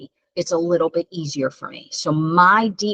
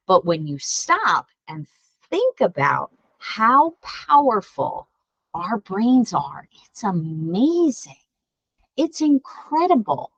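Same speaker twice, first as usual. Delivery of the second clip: slow awe; 4 semitones higher, slower than usual. Real, not acted. Marveling at the brain for kids.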